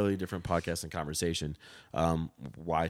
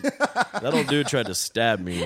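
loud, slow, laughing through the line; half-laughing at the absurd accusation; laughing retort; 3 semitones higher, 7 dB louder, slower than usual. Retold or imitated. Bartender to customers, laughing loudly. Retelling the bouncer story.

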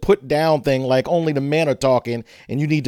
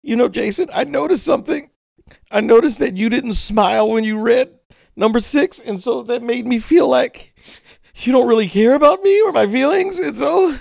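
much higher, whiny and wounded; mock hurt employee; 8 semitones higher than usual. Pretending to be a hurt employee talking to Jason, high whine. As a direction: play the oversensitive worker with a high whine.

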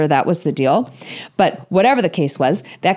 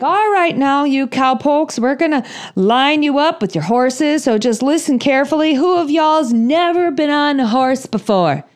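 higher, drawling trail-boss voice; cowboy wrangler; 6 semitones higher than usual. Retold or imitated. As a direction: play the folksy wrangler giving orders. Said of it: "he's like".

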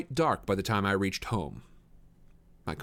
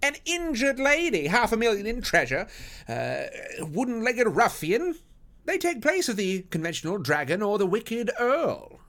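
excited lady; 12 semitones higher, 4 dB louder than usual. Acted. Lady recounts adventure as a romance, excited. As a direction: relish it at a high pitch. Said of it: "cried Missus Forrester".

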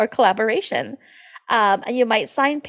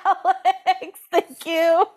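laughing through the name; 7 semitones higher, slower than usual. Real, not acted. Thanking her co-host while laughing. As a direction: giggle out the thanks.

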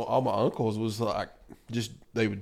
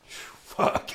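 a deflated, whispered fuck; whispered curse. Retold or imitated. Flipped off by the old man in the truck, whispered curse. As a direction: breathe the curse out with no voice.

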